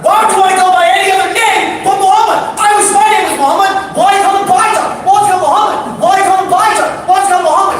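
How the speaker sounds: high shouting rant; shouting kid. Retold or imitated. Palestinian kid yelling at the Pakistani boys, high shout.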